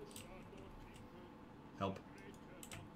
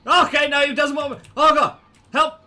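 high, loud panicked shouting; panicked yell; 11 semitones higher, 11 dB louder than usual. Real, not acted. Player blocked in a game, panicked yell.

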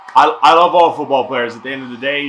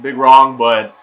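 normal talking voice

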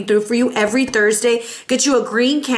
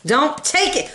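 normal talking voice

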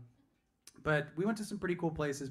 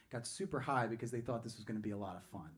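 ordinary talk

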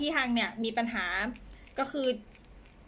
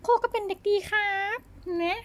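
much higher, louder cute pet voice; voicing the pet; 11 semitones higher, 4 dB louder than usual. Owner voicing her pet as a good boy, high and cute. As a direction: speak as the little pet, high and sweet.

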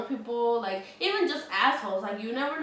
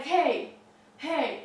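imitated higher, louder call; calling for attention; 5 semitones higher, 4 dB louder than usual. Drunk man pestering her on the bus, imitated calling out. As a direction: call out to someone ignoring you. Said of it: "trying to get my attention".

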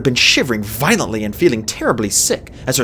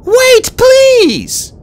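high, loud desperate plea; desperate cry; 9 dB louder than usual. A narrator's reading. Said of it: "cried Fate Speaker".

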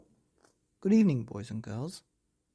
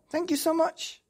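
very high, louder burst of thanks; grateful dinosaur; 5 dB louder than usual. Acted. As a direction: gush thanks in a squeaky-high pitch.

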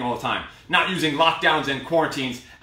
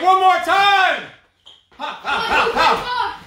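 high, loud, drawn-out call into a laugh; fired-up push; 13 semitones higher, 5 dB louder, much slower than usual. Real, not acted. Coach rallying the class for the final time, very high and loud. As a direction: stretch the call out and laugh through it.

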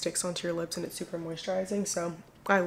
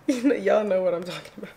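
laughing; 5 dB louder, faster than usual. Real, not acted. Laughing at makeup tutorial bit.

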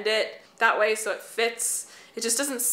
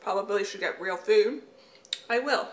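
talking around chewed candy; chewing while talking; mouth full. Real, not acted. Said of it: "I'm chewing on candy".

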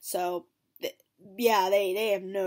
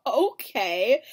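laughing okay; 3 semitones higher, 6 dB louder, much slower than usual. Retold or imitated. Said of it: "And I'm like".

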